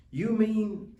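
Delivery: gasped. A narrator's reading. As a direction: catch your breath in wonder. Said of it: "Horton gasped".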